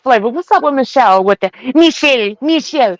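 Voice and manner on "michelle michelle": high mock-European call of the name; lightly hammed fake foreign accent. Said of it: "you use the accent for everything".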